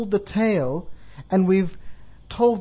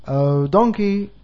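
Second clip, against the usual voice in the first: slow, louder drawn-out call; 5 dB louder, much slower than usual. Acted. Monkey calls again to an unresponsive donkey, drawn-out calling. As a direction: stretch it out to get his attention. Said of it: "starts to call".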